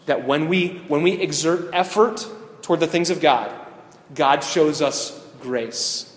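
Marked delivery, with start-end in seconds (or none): none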